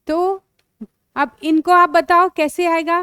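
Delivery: ordinary talk, nothing marked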